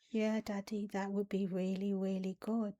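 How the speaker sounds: lightly voiced little girl; baby voice. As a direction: answer like a little girl who feels heard. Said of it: "when I was using that baby language".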